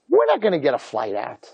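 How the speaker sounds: normal talking voice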